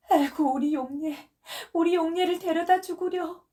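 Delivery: high, loud pleading wail. Acted. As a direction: beg for your lost daughter, wailing. Dying mother begs for daughter. (높고 큰 애원하는 울부짖음)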